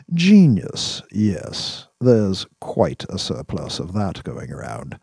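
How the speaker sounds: measured, deliberate man of authority; wry sophisticate making a careful case; dry, probing boss. Acted. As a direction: weigh each phrase, steering the young man. Voices Dax, dry and probing.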